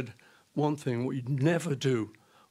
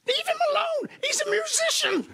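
high, put-on shrill plea of a wife; imitating wife pleading; 3 dB louder than usual. Wife pleading with muggers to spare the musician, high mock voice. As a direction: plead for him in a mock falsetto. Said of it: "She's saying".

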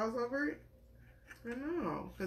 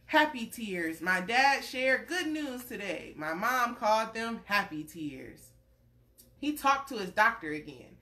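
gentle storybook read-aloud; reading to a child; 4 semitones higher, 5 dB louder than usual. Acted. Parent reading a picture book to a little one, gentle storytelling.

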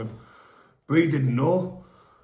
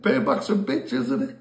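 mock gloating; 9 semitones higher than usual. Gloating to injured mate.

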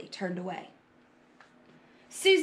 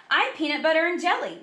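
put-on higher, louder, quick child's announcement; proud announcement; 7 semitones higher, 5 dB louder, faster than usual. A narrator's reading. Nady showing off lunch to the class, loud announcement. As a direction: announce it to the whole room. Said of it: "Nady announced to the class".

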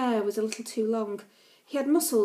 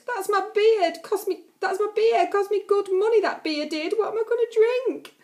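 high, loud wailing lament; much higher, louder complaining howl; 10 semitones higher, 6 dB louder than usual. Acted. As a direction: wail it like a bearded drunk robbed of beer. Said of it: "The man with the beard wailed".

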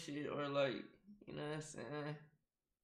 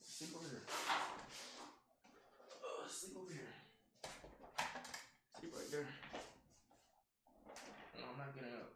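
hushed, much softer coaxing voice; 3 semitones lower, 19 dB quieter, faster than usual. Real, not acted. Streamer softly coaxing his dog to sleep on the cushion, hushed. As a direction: gently coax the dog to lie down.

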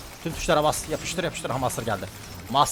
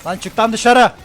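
much higher and louder, all-out yell; frantic shout; 10 semitones higher, 13 dB louder, slower than usual. Real, not acted. Streamer screaming at the game in a clutch fight, frantic yell. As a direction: scream it at the screen.

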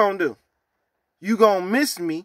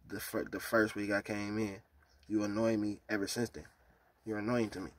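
much softer, low dismissive; cold quiet put-down; 7 semitones lower, 9 dB quieter than usual. Real, not acted. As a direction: say it flat and cold, almost under breath.

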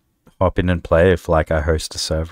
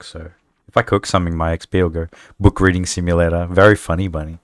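ordinary talk, nothing marked

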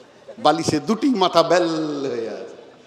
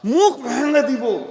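mock furious threat, pitched much higher; angry threat; 8 semitones higher, 4 dB louder than usual. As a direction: act out a furious threat to a boy. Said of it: "তা আমি খুব রেগে গেছি". (রাগী হুমকি, অনেক উঁচু গলায়)

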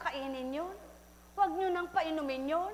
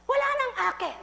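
high, loud cry of loss; demon's outcry; 10 semitones higher, 8 dB louder, faster than usual. A narrator's reading. Demon crying that no one is left, high and loud. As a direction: cry out in despair. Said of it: "Sabi niya".

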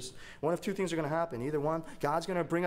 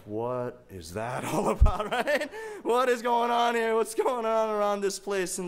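loud, laughing disbelief climbing high; mock-astonished outcry; 7 semitones higher, 8 dB louder than usual. Dad reacting to the toy's odd behavior, in mock-astonished outcry. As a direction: cry out in hammed-up bafflement, high and loud.

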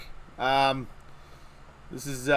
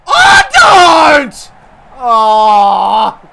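loud pained cry of don't; a big shout of despair at the game; anguished shout; 8 semitones higher, 16 dB louder, much slower than usual. Real, not acted. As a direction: yell it in real dismay. Bowler hit for six.

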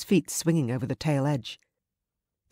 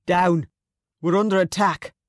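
loud, raised battle warning; urgent warning shout; 4 semitones higher, 6 dB louder than usual. Acted. Guard warning the women of an ambush, urgent shout. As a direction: shout the alarm to your party.

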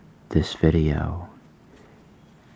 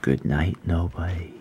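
bedtime story reading; 5 dB quieter than usual. Acted. Reads bedtime story to viewers.

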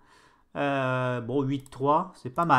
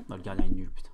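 whisper; 6 semitones lower, 4 dB louder, much faster than usual. Real, not acted. Chuchote un reproche au gardien.

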